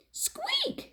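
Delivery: a tiny frightened squeak; soft mouse squeak. Acted. Second mouse fleeing the cat, high soft squeak. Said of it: "said him".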